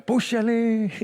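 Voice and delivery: high-pitched playful Spanglish; Mexican Spanglish. Retold or imitated. Preacher jokes about Mexicans' speech, mock Spanglish. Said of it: "todo lo hacemos spanglish".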